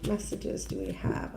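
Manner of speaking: whispered, voiceless repeat. Real, not acted. Reader whispering her question to the cards, voiceless. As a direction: breathe the question to yourself.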